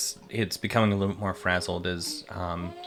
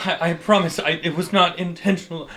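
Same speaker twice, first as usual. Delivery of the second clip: higher, pleading voice of a sorry spellcaster; apologetic NPC; 9 semitones higher, 4 dB louder than usual. Acted. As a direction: beg forgiveness in a raised character voice.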